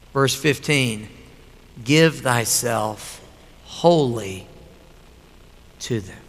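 very soft and low, words stretched slowly; hushed scripture reading. Real, not acted. Pastor reading the verse to the congregation, slow and hushed. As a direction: let each word of the verse hang in a near hush.

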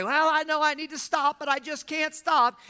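high, loud mock-helpless whine; harried protest. Voicing the harried person who can't rest.

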